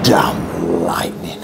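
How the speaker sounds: hushed, slow grumble at the storm; muttered whisper. Real, not acted. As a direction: curse the lightning under your breath, slowly. Startled by lightning mid-story.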